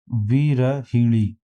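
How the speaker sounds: वीरहीणी very slowly, in separated syllables; वीरहीणी stretched out syllable by syllable; correct pronunciation. Shown as a demonstration. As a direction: spell out वीरहीणी by ear, vee-ra-hee-ṇee. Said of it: "सही उच्चारण इसका होता है".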